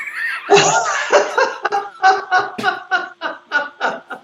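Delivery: loud, high cackling; uproarious laughing fit; laughter. Real, not acted. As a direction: howl with laughter at the joke. After dating site punchline.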